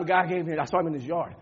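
usual speaking voice

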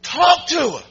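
high, loud, slow shouted preaching; preaching at full force. Real, not acted. Pastor exhorting church to speak to storms, shouting. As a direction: thunder it out to the congregation.

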